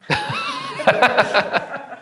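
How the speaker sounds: high-pitched burst of laughter; long high laugh. Real, not acted. Laughter at the command demo.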